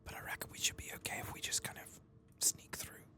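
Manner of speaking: hushed, unvoiced whisper; sneaky whisper. Player whispering to viewers while sneaking past Twilight Binders, hushed whisper. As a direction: whisper it as if creeping past someone.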